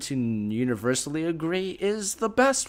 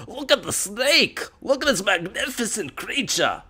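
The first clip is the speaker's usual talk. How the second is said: excited Aussie wildlife host; Australian accent; 7 semitones higher than usual. Pretend. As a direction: gush over the snake like Steve Irwin. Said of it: "him playing with Voldemort".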